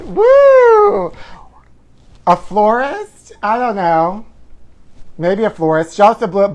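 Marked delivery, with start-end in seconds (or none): 0.0-1.1 very high, loud party whoop; shrieked pride cheer. Real, not acted. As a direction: cheer it like a parade float. Cheering Happy Pride sign-off.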